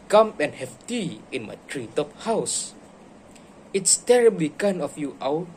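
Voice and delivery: put-on lively read-aloud for kids; animated bedtime story voice; storybook reading. A narrator's reading. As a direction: read it like a picture book to a class. Teacher reads The Gruffalo aloud.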